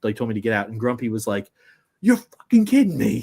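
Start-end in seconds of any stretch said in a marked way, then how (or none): 2.0-3.2 high, loud, outraged yell; imitating dad's loud disbelieving curse; Grumpy's outburst. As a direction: blurt it out like the grumpy dad hearing bad news. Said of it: "in this typical Grumpy way".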